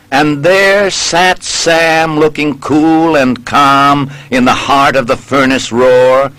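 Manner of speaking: usual speaking voice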